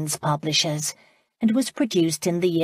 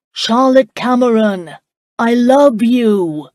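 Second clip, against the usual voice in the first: louder, slower ringing declaration of love; loud, strong voice; 5 semitones higher, 10 dB louder, slower than usual. Acted. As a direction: call it out strongly from the road so all can hear. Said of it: "said in a loud, strong voice".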